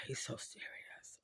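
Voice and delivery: barely voiced whisper; whispered aside. Real, not acted. Confiding about a serious friend, whispered.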